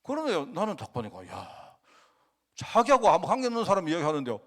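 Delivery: ordinary talk, nothing marked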